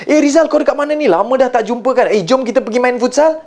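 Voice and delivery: high, lively invitation; excited close friend. Acted. Close friend phones to invite to futsal, excited. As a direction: pour it out high to an old pal. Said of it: "rakan karib kita telefon kita".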